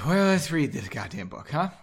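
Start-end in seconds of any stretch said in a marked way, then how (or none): none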